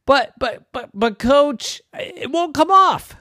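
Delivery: high, loud, slow nervous stammer; boy stammering to coach, high and slow. Acted. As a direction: plead with the coach, tripping over words.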